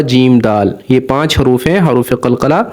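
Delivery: ordinary talk, nothing marked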